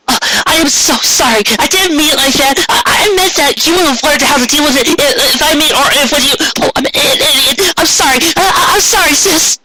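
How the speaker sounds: put-on high, tumbling, mortified older sister; flustered apology. Older sister apologizing to her sister after a blunder, high and flustered. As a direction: pitch way up and stumble through the apology.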